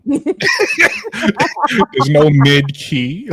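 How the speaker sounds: drawn-out words breaking into laughter; laughing. Real, not acted. Arguing his basketball point to the panel, laughing. Cracks up at own argument.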